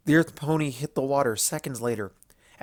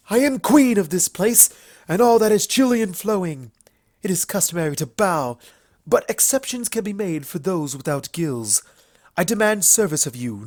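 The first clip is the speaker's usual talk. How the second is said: higher, louder hammed-up evil queen; melodramatic villain queen; 5 semitones higher, 5 dB louder than usual. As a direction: ham up a mock water tyrant for laughs. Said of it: "over-exaggerated melodramatic".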